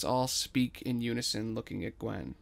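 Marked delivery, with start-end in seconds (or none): none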